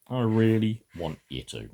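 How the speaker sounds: ordinary delivery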